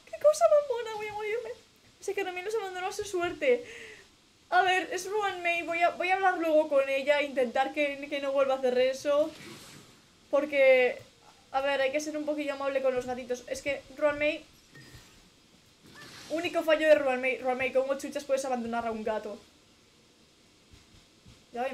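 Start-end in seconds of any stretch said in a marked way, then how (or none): 0.0-1.5 high, loud cutesy squeal; dying of cuteness; shrieked high and slow. Real, not acted. Squealing over kitten. 4.5-19.4 indignant. Real, not acted. Indignant rant about cat.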